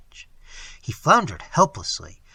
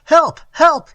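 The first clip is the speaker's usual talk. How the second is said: high, loud terrified wail; 12 dB louder than usual. Acted. Moneylender being engulfed wails for help, loud terrified wail. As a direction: scream it in panic. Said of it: "he wailed".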